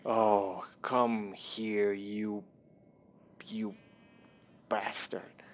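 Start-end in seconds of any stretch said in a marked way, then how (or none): none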